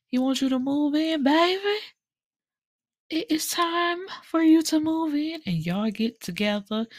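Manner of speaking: high, sweet coaxing friend voice. Retold or imitated. A friend urging Miss Mac to move in, high coaxing voice. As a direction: sweet-talk her into moving in.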